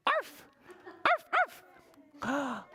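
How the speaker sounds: high, separate yapping barks; dog bark. Acted. Puppy George finally barking right, high arfs. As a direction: yap "arf" like a puppy. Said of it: "And George said".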